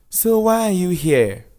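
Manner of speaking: put-on teenage boy voice. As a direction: push back like a skeptical kid.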